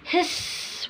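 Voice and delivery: soft unvoiced hiss. Shown as a demonstration. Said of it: "heard the sound".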